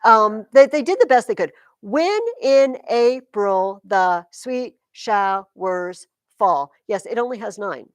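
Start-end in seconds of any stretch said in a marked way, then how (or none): 1.8-6.7 slow, separated syllables. Shown as a demonstration. Teacher checking how many syllables the line has, slow and separated. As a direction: count it out beat by beat.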